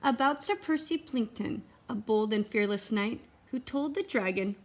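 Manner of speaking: put-on lively read-aloud to children; storybook reading. A narrator's reading. Reading a picture book to the children, lively storybook voice. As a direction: read it like bedtime for the kids.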